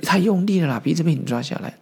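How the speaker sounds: high yelp of pain, half-played; a retold yelp of pain; mock pained yell. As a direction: cry out as if your nose is grabbed. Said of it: "痛得西唐大叫说".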